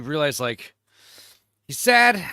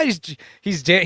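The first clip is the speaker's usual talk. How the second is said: normal talking voice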